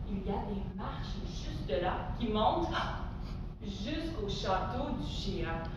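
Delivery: low, soft, secretive reveal; hushed wonder. Pretend. Teacher revealing steps to the giant's castle, hushed wonder.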